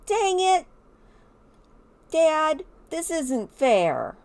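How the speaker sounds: high, loud, pretend-upset kid voice; whiny daughter. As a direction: play a put-out child, high and dragged out. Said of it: "She's like".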